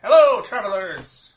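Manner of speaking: high, loud, slow hobbit greeting; cheery innkeeper. Acted. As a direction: boom out a jolly welcome. Innkeeper greets travelers loudly.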